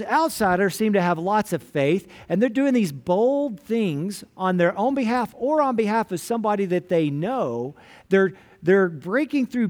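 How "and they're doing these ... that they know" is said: ordinary talk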